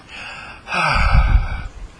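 high, unvoiced, satisfied exhale; demonstrating a lovely sigh. Shown as a demonstration. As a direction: sigh out all your tension. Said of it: "like a lovely sigh".

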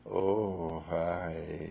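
soft, low, melting hello. Retold or imitated. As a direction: melt into the greeting. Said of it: "she just sort of melts".

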